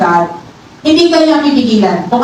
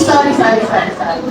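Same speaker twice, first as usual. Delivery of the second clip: embarrassed apology; 5 semitones lower, faster than usual. Real, not acted. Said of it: "Sorry guys, I'm so hirap".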